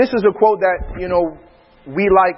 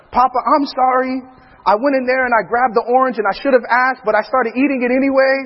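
put-on higher-pitched child's confession; little son apologizing; 6 semitones higher than usual. Son confessing to his Papa, high imitated child's apology. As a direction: imitate a small boy owning up. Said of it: "Zen is the first one".

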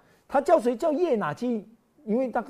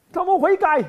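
mock joyful God; 13 semitones higher, 9 dB louder than usual. God's joy voiced.

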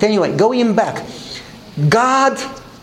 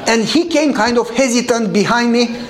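ordinary delivery

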